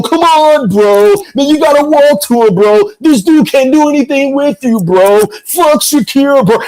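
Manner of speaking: high-pitched hyped-up cornerman impression; imitated hype man. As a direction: egg the fighter on in a high put-on voice. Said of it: "I can just hear him right now".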